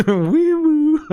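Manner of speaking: high wee woo siren call; playful siren noise; mock siren. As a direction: sing it like a siren. Mock siren again, laughing.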